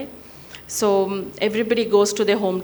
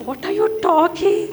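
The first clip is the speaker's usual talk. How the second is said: incredulous; 8 semitones higher than usual. Retold or imitated. Woman reacts to the odd advice.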